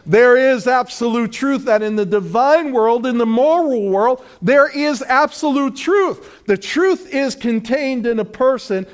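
loud, high, building proclamation; full-force preaching. Acted. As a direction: preach it out with rising fire.

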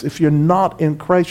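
ordinary talk, nothing marked

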